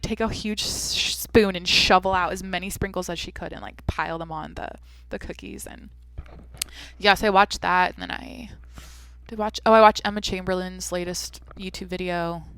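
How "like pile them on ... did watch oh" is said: ordinary talk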